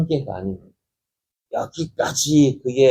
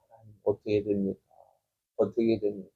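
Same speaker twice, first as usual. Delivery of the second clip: quiet anxious prayer; 8 dB quieter, faster than usual. Acted. David asking God what will happen, quietly praying. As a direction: murmur an acted prayer to God.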